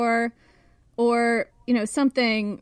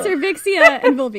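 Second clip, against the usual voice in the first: loud, high-pitched, breaking into laughter; laughing hard; 8 semitones higher, 8 dB louder than usual. Real, not acted. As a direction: squeal it through real laughter. Cracking up repeating the joke names.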